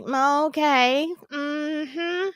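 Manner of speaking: drawn-out, doubtful okay; retold skepticism. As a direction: hum along as if unconvinced. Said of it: "it was just weird to me".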